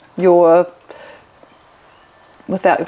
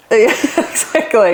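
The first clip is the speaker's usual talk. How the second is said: laughing; 5 semitones higher, much slower than usual. Real, not acted. Laughing agreement.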